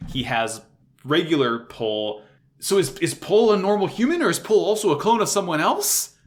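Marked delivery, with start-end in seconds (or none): 2.6-6.2 higher and louder, spiraling up to a yelp; questions tumbling out faster and higher; racing mind. Real, not acted. Fan spiraling over clone theories, excited and rising. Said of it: "My mind is racing".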